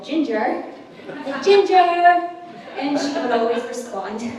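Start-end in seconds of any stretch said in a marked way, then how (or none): 0.0-0.7 half-hearted call of GINGER; a first, restrained mock yell; retold yell. Friend calling across campus to Ginger, restrained yell. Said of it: "I would yell". 1.4-2.3 full yell, high and loud. Retold or imitated. Friend calling across campus to Ginger, full-out yell. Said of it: "I would yell".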